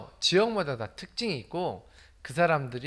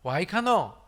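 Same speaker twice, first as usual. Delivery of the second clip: higher-pitched Gyeongsang dialect question; short Gyeongsang-accented question, pitched up; 3 semitones higher, 4 dB louder, slower than usual. Shown as a demonstration. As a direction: ask it like a Gyeongsang local. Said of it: "경상도는요".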